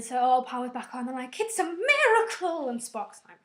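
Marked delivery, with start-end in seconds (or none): 1.4-2.7 excited cheer. Retold or imitated. Crew cheering power restored.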